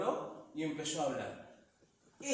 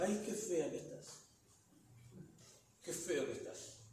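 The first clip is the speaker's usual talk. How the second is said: murmured inner voice; 8 dB quieter than usual. Pretend. Voicing an intrusive thought.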